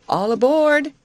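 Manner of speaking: high, loud boarding call; cried out. A narrator's reading. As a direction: call it out to everyone aboard. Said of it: "cried Betty as the engine was started".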